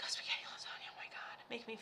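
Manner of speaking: breathy whisper, craving food. Retold or imitated. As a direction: hush it like a secret food craving. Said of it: "I'm like".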